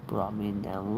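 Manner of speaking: normal talking voice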